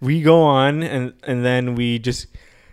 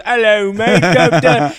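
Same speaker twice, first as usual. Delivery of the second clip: mock British accent, higher and louder; 7 semitones higher, 6 dB louder, slower than usual. Imitating a comedian mocking British people, mock British accent. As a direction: ham up a cheeky British greeting. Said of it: "makes fun of British people".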